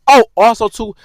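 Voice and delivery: ordinary delivery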